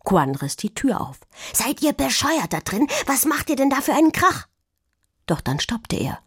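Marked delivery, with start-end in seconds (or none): 1.5-4.5 high, indignant outburst; indignant scolding. A narrator's reading. Quan tears the door open on the noisy pair, indignant. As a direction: burst in and tell them off, pitched high.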